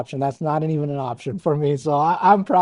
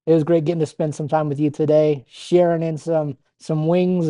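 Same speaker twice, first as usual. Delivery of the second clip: ordinary delivery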